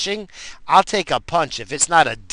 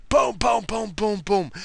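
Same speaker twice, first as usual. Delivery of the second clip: high booms stepping downward; tumbling sound effect; 6 semitones higher than usual. Retold or imitated. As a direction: imitate a body bumping down stairs. Imitates teacher tumbling down the stairs.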